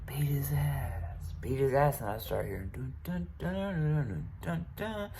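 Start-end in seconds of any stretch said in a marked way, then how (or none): 0.1-2.0 slow, higher whisper; whispered egging-on. Retold or imitated. I'm hearing. 2.7-5.1 higher, soft hummed jingle; video game music hum. Retold or imitated. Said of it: "It's like a video game sound".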